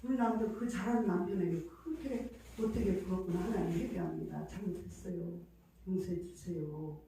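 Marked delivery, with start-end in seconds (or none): 3.4-7.0 soft, lowered prayer of repentance; quiet repentance. Retold or imitated. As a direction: pray softly for forgiveness. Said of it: "어머나, 그런 사람도 저렇게 했는데".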